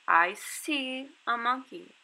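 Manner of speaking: see stretched and stressed in slow line. Shown as a demonstration. Teacher modeling sight word see for kids. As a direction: lean on see, slowly. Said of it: "emphasizing the word see".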